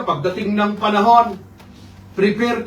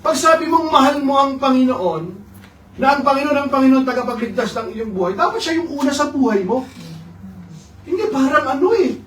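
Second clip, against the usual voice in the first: fervent preaching; 7 semitones higher than usual. Real, not acted.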